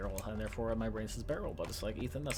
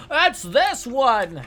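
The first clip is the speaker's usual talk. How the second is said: excited outburst; 12 semitones higher, 13 dB louder, much slower than usual. Real, not acted. Found the answer, excited.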